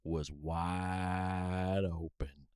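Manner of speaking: very slow, drawn-out solemn proclaiming; slow grand declaration. Pretend. Friend ends the bit with a very slow mock-grand line. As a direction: stretch the words out like a grand pronouncement.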